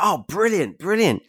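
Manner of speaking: high, loud sarcastic "brilliant"; mock delight. To his mentor, mock-thrilled brilliant. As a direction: overplay fake joy at bad news. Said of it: "And I was like".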